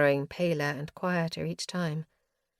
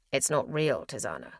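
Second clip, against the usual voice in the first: low steady; 6 semitones lower than usual. A narrator's reading. Max holding her back.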